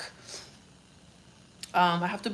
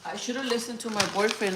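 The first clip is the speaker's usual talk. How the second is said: usual speaking voice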